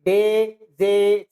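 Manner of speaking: रे, डे, जे stretched out slowly; slow letter drill. Shown as a demonstration. Teacher recapping the letters slowly for the class. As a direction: linger on each letter. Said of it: "आवाज एक जैसे आती है".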